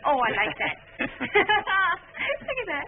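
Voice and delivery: laughing delight. Acted. Wife watching honeymoon film.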